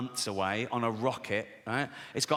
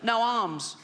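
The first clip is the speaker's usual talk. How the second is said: higher, strained child impression; funny little boy's voice; 6 semitones higher than usual. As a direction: answer as the chesty little lad. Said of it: "He's got a funny little voice".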